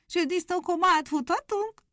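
high, eager hare. Acted. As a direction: ask impatiently. Hare asks to start.

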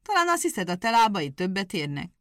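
offended hedgehog challenges the hare, indignant. Acted. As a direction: throw the question back at him.

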